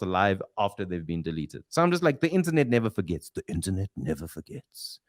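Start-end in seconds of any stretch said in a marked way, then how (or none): 3.3-5.0 much lower, softer, regal lion-king rumble; deep wise lion king pronouncing a truth; Mufasa voice. Retold or imitated. Host drops into Mufasa's voice for the internet line. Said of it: "Sounds like something Mufasa says".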